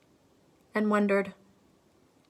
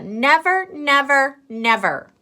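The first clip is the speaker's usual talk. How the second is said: repeated refusal; 7 semitones higher, 8 dB louder than usual. Acted.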